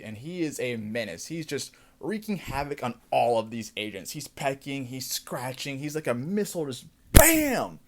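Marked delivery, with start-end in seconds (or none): 5.8-7.8 sudden loud "BAM!" like an impact; loud mock crash sound effect; shouted bam. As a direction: slam out the BAM like a missile hit. Describing Eagly hitting agents.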